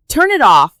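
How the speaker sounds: high, loud screeched order. A narrator's reading. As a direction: screech it in desperation. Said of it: "she screeched".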